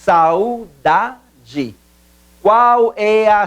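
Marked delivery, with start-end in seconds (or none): 0.0-1.7 "saudade" split as sau, da, de; "saudade" slowly, in three detached syllables. Shown as a demonstration. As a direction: break "saudade" into three pieces with short gaps. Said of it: "Separação silábica".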